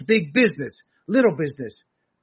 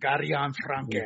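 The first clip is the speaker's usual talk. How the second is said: Guerrion said with a put-on foreign accent, lower and softer; 6 semitones lower, 6 dB quieter, slower than usual. Shown as a demonstration. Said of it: "I love saying it with the accent".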